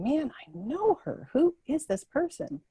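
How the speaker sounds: reach for a face you almost know; wondering. Retold or imitated. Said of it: "And I'm thinking".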